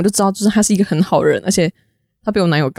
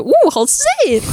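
mock delighted surprise. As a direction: pitch shoots way up on 哦. Tasting the mochi in retelling. (惊喜地说好吃)

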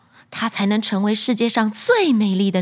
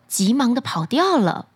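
usual speaking voice

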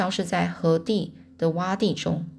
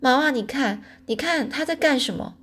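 high-pitched tattling cry; raised, indignant child's call; yelling; 6 semitones higher than usual. A narrator's reading. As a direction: cry out to mom in alarm. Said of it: "黛西边嚷边指着说".

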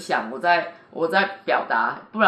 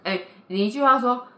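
ordinary talk, nothing marked

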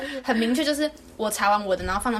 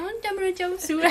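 higher, whiny mock voice, half laughing; mocking whiny classmate; 8 semitones higher than usual. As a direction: whine the excuse like the classmate. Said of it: "最后在那边". (学同学的委屈语气)

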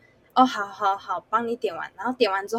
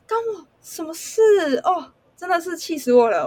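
high, near-tearful outburst; exasperated cry; 7 semitones higher than usual. Real, not acted. Server venting about unfair blame, exasperated and near tears. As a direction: let the frustration crack through.

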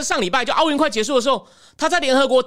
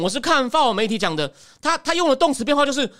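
usual speaking voice

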